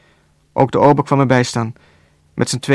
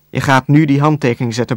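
usual speaking voice

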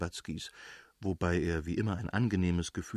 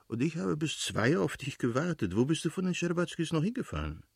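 higher, livelier voice for a sociable friend; playful reproach, pitched up; exuberant Oblonski, reproachful; 7 semitones higher than usual. Acted. Friend asks where he went last night, exuberant and higher.

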